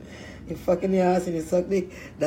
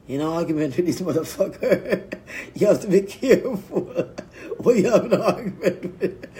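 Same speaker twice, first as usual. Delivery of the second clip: laughing. Real, not acted. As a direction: talk through laughter. Laughing while warning listeners.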